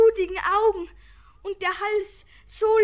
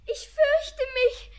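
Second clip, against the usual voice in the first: high and slow, close to crying; a scared little girl fighting tears; frightened, tearful; 6 semitones higher, slower than usual. Acted. Regitze tells Anna she is afraid, high, slow and tearful.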